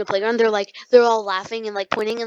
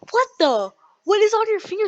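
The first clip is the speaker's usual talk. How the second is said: mock disgusted shock; 10 semitones higher, 4 dB louder than usual. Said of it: "I was like".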